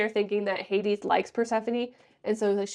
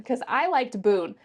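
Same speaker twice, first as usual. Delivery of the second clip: ordinary delivery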